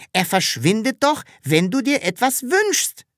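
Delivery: high, insistent exclamation; excited cry of explanation; calling out. A narrator's reading. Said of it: "rief das Sams".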